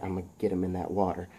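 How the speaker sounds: low, hushed, quick aside to himself; quiet plotting. Acted. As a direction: mutter the plan privately. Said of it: "He's like".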